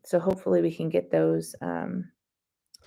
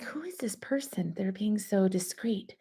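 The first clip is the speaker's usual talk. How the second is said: hushed whisper; 2 semitones higher, 6 dB quieter than usual. Retold or imitated. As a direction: breathe it like a secret wondering who this is. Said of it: "'Cause I'm like".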